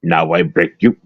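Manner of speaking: very low, halting kung fu movie dub; dubbed kung fu villain. Acted. Co-host auditioning as narrator for old kung fu movies, dubbed villain voice. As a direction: do a deep, badly dubbed martial arts fighter. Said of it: "do narration on some, uh, old, uh, public domain kung fu movies".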